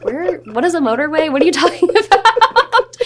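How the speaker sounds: high-pitched, laughing mock confusion; mock bafflement. Castmates puzzled by an unknown word, imitated mock bafflement. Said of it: "We were all like".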